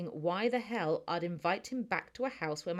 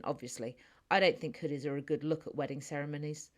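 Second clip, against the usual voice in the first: usual speaking voice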